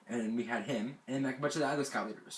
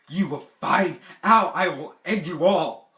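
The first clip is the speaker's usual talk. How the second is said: loud, slow, higher-pitched retold threat; mad threat; 4 semitones higher, 10 dB louder, slower than usual. Camper warning everyone at breakfast, loud slow angry threat. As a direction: spell out the threat slowly and loudly. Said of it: "And I was so mad".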